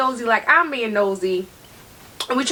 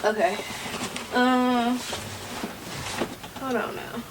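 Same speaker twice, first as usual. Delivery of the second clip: ordinary talk, nothing marked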